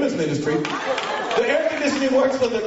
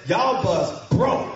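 slow country drawl with extra syllables; stretched country drawl; 2 semitones lower, much slower than usual. Shown as a demonstration. Mechanic giving the worse verdict, slow country drawl. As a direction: draw it out for emphasis. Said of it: "extra syllables, it's for emphasis".

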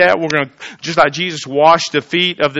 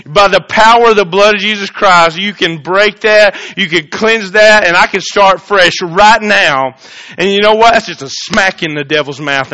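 loud, high preaching voice; fervent sermon delivery; 5 semitones higher, 9 dB louder than usual. Acted. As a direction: preach it out to the congregation. Preaching at full force.

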